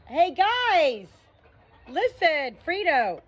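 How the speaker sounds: high, loud call across the room; sudden high shout to the pet; calling the dog. Real, not acted. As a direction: call out to get the dog's attention.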